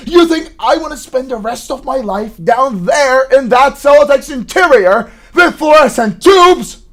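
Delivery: loud, high, indignant tirade; angry outburst. Acted. Son railing to his mother about his job, shouting angrily. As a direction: hurl the question at full voice.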